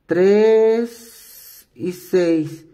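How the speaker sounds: tres y seis counted very slowly; slow counting. Shown as a demonstration. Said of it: "voy a contar tres". (contando tres y seis muy despacio)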